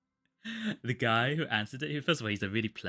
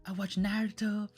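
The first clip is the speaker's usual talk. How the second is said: much higher, gushing fan voice; imitating an eager fan; 12 semitones higher, slower than usual. Phone agent gushes about Dragon Ball in a high eager voice. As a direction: gush like a delighted fan. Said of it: "He was like".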